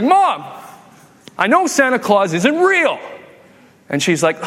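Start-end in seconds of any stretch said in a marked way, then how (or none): 0.0-3.0 high-pitched kid insisting, acted out; insistent child. Kid presses mom about Santa, insistent child voice. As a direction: call out Mom and press the accusation in a raised kid voice.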